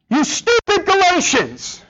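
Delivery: hammed-up holy anger, slowly spat out; angry Paul. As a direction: scold them like an exasperated apostle. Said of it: "He's a little angry".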